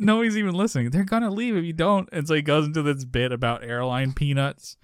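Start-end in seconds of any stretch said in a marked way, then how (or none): 0.0-2.1 urgent pleading. Retold or imitated. Acted exchange with urging mother.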